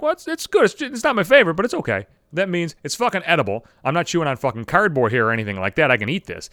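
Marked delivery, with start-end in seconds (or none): 0.0-2.1 awkward polite. Retold or imitated. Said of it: "and they're like".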